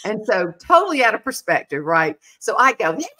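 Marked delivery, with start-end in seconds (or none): 2.9-3.1 much higher, fast, entitled whining; mock the spoiled traveler griping about the airline; whiny diva complaint. Wife griping to a stranger about the airline, whiny diva. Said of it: "he let me whine and go pitiful".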